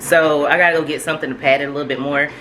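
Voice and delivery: normal talking voice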